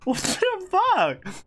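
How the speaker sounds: high, slow, drawn-out shock; disbelief. Real, not acted. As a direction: stretch it out, stunned. Stunned by game event.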